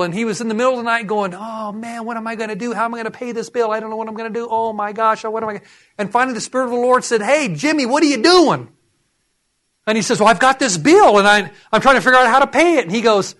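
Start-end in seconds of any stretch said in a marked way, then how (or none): 1.3-5.6 softer, higher fretting; retold worrying. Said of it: "he was worried about a T V bill". 7.2-8.7 high-pitched call to a worrier; mock divine interruption. 10.2-12.8 flustered explaining. Retold or imitated. Worrier explains himself to God.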